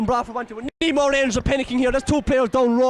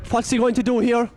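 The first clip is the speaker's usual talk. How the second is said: ordinary talk, nothing marked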